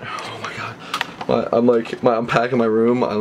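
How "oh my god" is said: barely audible, breath-only whisper; stunned whisper. Real, not acted. Freaking out while PayPaling out, stunned whisper. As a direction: whisper it to yourself in disbelief.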